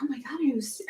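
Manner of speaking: retold gasp, then whispered concern; shocked whisper. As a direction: whisper it as if stunned by bad news. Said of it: "And I was like".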